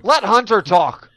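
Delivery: loud playful shout. Real, not acted. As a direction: yell it over the others. Shouting to let Hunter speak.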